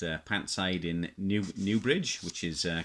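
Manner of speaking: normal talking voice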